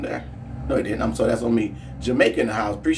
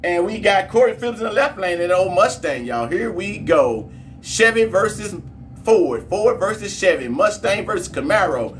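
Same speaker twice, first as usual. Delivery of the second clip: excited, higher play-by-play hype; race hype; 9 semitones higher, 3 dB louder than usual. Acted. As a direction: pump the chat up for the next race. Calls the drag race live.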